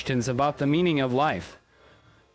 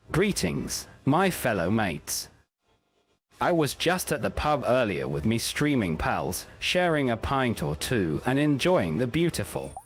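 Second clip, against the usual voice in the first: put-on a lower, slowed-down British accent, like a bloke at the pub; 3 semitones lower than usual. Streamer trying a British accent for chat, a lower British accent. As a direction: put on your best British accent, mate. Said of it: "let me give this a try in my best British accent".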